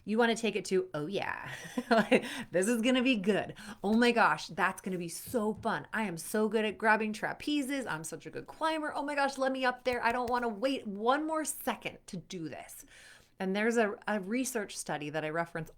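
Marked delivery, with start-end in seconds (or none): 0.9-12.8 hammed-up eager self-talk; pumped-up excitement. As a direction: hype yourself up, can't wait to go. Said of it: "if you're in this high energy".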